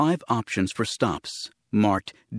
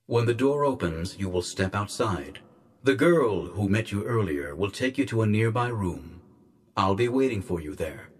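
quiet, measured instructions from an unseen official; disembodied guardian voice; 3 dB quieter than usual. Acted. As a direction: give orders softly and formally.

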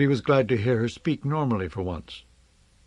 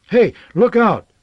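loud, high yell of warning; shouted warning; 10 semitones higher, 10 dB louder than usual. A narrator's reading. People in the street warning him, shouting loudly. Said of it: "they shouted".

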